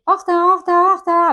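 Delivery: high, loud fretting rabbit cry. Retold or imitated. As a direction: squeal it like the late rabbit from Alice. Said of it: "le lapin d'Alice au pays des merveilles, tu sais, qui dit".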